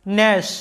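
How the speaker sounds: ন্যাশ said "nash", g dropped; ন্যাশ as one syllable, no g; English word gnash. Shown as a demonstration. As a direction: clear model of ন্যাশ with silent g. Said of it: "জিটার কোনো উচ্চারণ নাই".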